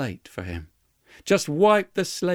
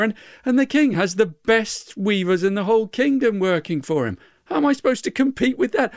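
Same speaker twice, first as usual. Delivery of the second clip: high, rushed anxious stammering; a flustered weaver who doubts himself; panicked protest; 9 semitones higher, 4 dB louder than usual. Acted. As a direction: weaver frets to the angel about the king's weavers, high and hurried.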